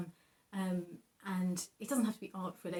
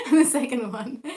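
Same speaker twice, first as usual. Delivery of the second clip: laughing, louder; 4 semitones higher, 13 dB louder than usual. Real, not acted. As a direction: burst out loud with a laugh. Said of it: "oops, sorry".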